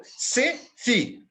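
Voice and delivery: "se … FI", two long, high beats; syllable scansion. Shown as a demonstration. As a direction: hold each stressed syllable of "se fique". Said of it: "Então, duas sílabas". ("se fique" escandido devagar, parando no "fi")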